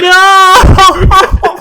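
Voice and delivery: high, loud mock-horrified shriek of no; shocked squeal. Real, not acted. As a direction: shriek it like you heard something terrible. Reacting to cursed line.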